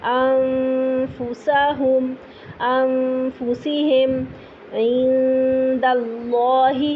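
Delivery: انفسہم with long nasal hum before fa; ikhfa recitation. Shown as a demonstration. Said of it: "قریب قریب مخرج جائیں گے".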